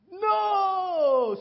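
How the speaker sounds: a high, loud no; loud dismay. Acted. Lawyer says it gets worse, high loud no. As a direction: cry out in mock despair. Reacting to bad news.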